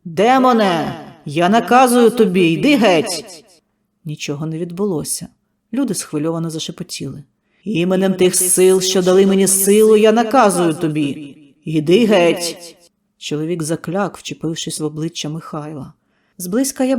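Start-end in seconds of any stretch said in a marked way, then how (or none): 0.0-3.4 loud shouting command, ringing; shouted exorcism. Acted. Healer shouting at the demon inside Mykhailo, commanding. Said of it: "прокричав". 7.6-12.8 loud, drawn-out exorcism, stress on я; slow shouted command. Acted. Healer ordering the demon out, loud and slow.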